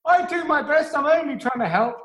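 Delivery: lightly voiced eager-to-please rescuer. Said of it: "The rescuer says".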